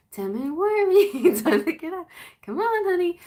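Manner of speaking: sweetly insistent, giggling a little; playful wake-up warning. Retold or imitated. Mother hurrying the sleepy kid, high and giggly. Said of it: "or I'll start with ten".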